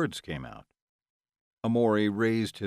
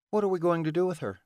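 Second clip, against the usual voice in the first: higher, quicker female character voice; Delilah asking; 9 semitones higher, faster than usual. Acted. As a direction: ask it fast and high. Acted question of Delilah.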